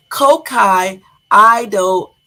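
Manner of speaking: Kokai, Ido said loud and emphatic. Shown as a demonstration. Streamer loudly repeating his earlier guess. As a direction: call out Kokai as if sure now. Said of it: "what the hell did I say?".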